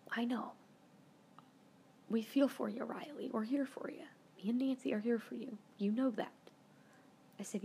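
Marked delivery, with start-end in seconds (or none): 0.1-6.3 hushed, gentle retelling of a friend's comfort; quiet, tender words to a crying friend; soft comforting whisper. Retold or imitated. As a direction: whisper it like consoling someone in tears. Friend comforting her, retold.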